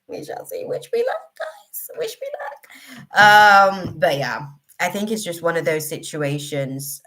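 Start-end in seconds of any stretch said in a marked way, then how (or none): 0.1-0.6 breathy unvoiced whisper. Whispering a teasing aside to viewers. 0.7-3.2 high then whispered plea. Acted. Whispering to viewers for luck.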